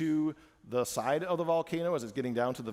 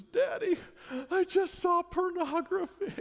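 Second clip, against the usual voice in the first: put-on much higher, slow child's voice; little daughter voice; slower than usual. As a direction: speak like a small girl confessing. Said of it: "She says".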